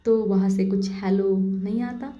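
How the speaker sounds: ordinary talk, nothing marked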